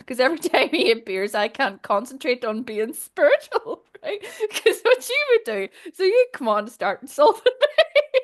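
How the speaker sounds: words breaking up with real laughter; laughing. Real, not acted. Teasing Joe about the insulting doctor boy, laughing. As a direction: giggle through it, pitch climbing high. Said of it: "I shouldn't be laughing".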